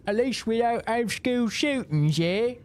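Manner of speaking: high, loud mocking British accent. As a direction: sneer like a smug Brit in a British accent. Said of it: "Brits doing the Brit thing".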